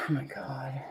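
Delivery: whispered aside. Real, not acted. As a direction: breathe it under your breath. Embarrassed aside.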